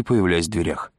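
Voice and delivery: usual speaking voice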